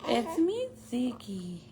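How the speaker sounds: voicing the baby. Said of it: "Say hi, pretty girls".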